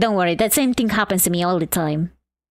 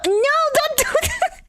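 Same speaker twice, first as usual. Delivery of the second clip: high squeaky wail; high mock-panicked no; playful panic. Real, not acted. Panicked cry to herself.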